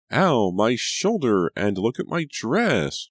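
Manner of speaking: slow, loud and high wailing.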